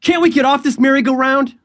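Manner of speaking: high, held-pitch theatrical plea; hammy stage acting. Lead recreating his high school play performance, hammy and high-pitched. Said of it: "summarize my entire performance, imagine me gesturing".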